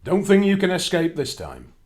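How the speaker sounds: high, loud giant threat; shouting giant. Acted. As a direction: yell it across the land. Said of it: "he was shouting".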